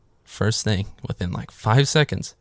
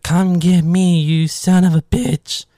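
higher, louder taunting shout, slowing on the insult; defiant yell; 9 semitones higher, 6 dB louder, slower than usual. Retold or imitated. Girl on the stairs yelling a defiant dare at the killer.

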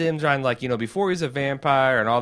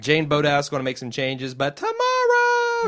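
put-on drunk girl voice; 5 semitones higher, 4 dB louder than usual. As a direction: slur it like a tipsy party girl. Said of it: "she says in her drunk girl voice".

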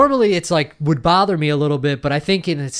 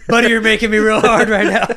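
higher, louder, words broken up by laughter; can barely get it out for laughing; laughing through words; 7 semitones higher, 5 dB louder than usual. Acted. Friend cracks up mocking the teacher, laughing through it.

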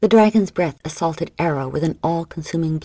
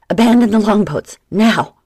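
loud, higher warning yell; first mate yelling to the longboats; urgent shout; 5 semitones higher, 9 dB louder than usual. Acted. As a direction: scream the warning across the water. Ginger warns crew of fire.

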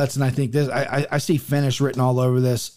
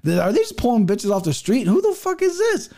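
climbing to a high, scoffing question; mock outrage building to a squeal; incredulous outburst; 4 semitones higher than usual. Mocking the matchmakers for booking an unknown, rising high disbelief. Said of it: "'cause I said".

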